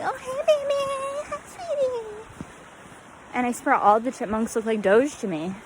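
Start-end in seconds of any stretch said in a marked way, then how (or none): 0.0-2.1 put-on high cooing baby voice; baby talk to a pet. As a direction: coo at a chipmunk like a pet. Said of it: "I'm just like".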